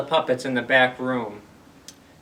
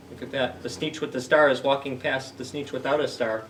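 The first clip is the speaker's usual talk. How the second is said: usual speaking voice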